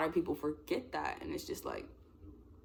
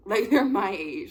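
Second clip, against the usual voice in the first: laughing; 4 semitones higher, 9 dB louder, slower than usual. Real, not acted. Laughs making the point.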